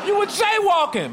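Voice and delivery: very high, indignant mock-cop cry; accusing cop shout. Cop stopping a jaywalker who walks off, high accusing cry. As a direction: squawk it as an outraged policeman.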